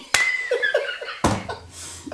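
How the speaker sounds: squealing high-pitched laugh; high shriek laugh. Real, not acted. Scared during gameplay.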